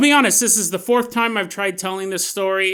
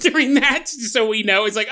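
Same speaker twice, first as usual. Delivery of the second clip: normal talking voice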